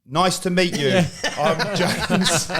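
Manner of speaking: laughing mock introduction; laughing polite intro. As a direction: mimic the naive new lad while cracking up. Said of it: "the lads would be like".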